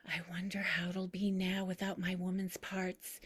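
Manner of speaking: soft confiding whisper. Acted. Grandmother pulling granddaughter close, whispering. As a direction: lean in and whisper the secret. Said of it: "pulls me in close and whispers".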